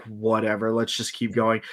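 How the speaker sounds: low, quiet, flat-out indifference; bored dismissal. Retold or imitated. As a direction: shrug it off, barely bothering. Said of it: "I'm just like".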